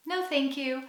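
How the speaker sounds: nice intonation. Shown as a demonstration. Customer politely declining at a cafe, with a nice smiling intonation. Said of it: "a nice intonation and a smile".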